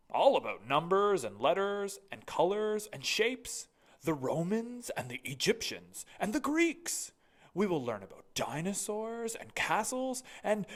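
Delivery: excited headmaster. Acted. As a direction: gush about all the learning. Mister Keene's voice.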